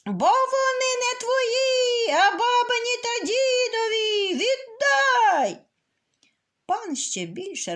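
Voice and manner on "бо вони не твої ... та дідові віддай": high, loud, strained rooster cry; rooster's louder shout. Acted. Rooster thrown out of the geese pen, yelling at the master. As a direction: yell it even harder than before, voice cracking. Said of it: "та ще гучніше кричить".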